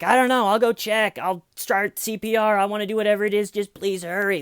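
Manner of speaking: high, pleading fake panic; feigned urgency. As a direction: act desperate to help the emergency operator. Acting out faked panic for dispatcher.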